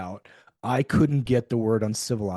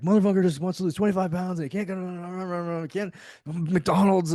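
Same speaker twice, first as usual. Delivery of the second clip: huffing rant; 7 semitones higher than usual. Retold or imitated. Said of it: "huffing and puffing around the apartment".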